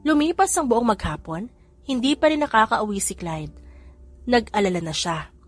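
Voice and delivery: ordinary delivery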